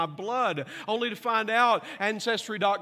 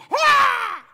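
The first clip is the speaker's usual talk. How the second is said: loud, high mock shriek; imitated scream; 12 dB louder than usual. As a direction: shriek as the girls see him. Daughters scream at him.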